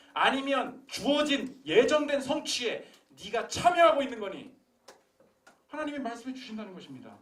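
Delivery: loud, high fervent preaching; preaching at full force. Acted. Pastor challenging the congregation, loud fervent preaching. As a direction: drive it home to the pews.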